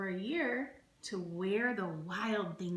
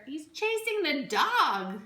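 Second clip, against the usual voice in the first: excited; 7 semitones higher, 6 dB louder than usual. Acted. Excited picture commentary.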